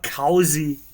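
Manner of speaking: COZY drawn out as "KOW-zee", loud; disputed pronunciation. Real, not acted. Streamer rejecting the chat's correction, disputed pronunciation. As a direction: say COZY slowly with an OW glide. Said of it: "It's not cozy".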